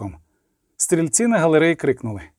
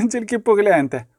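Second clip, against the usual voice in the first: high-pitched voice breaking into laughter; laughing hard; 6 semitones higher than usual. A narrator's reading. Girl realizes her mistake with the helmet, laughing. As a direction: giggle through the words in a higher voice. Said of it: "зайшлася від сміху".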